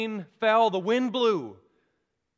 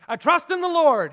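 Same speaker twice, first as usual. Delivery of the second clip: loud, higher defiant call; shouted back; 5 semitones higher, 6 dB louder than usual. Retold or imitated. Stubborn man shouting back to the helicopter crew, loud and higher. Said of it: "He shouted back".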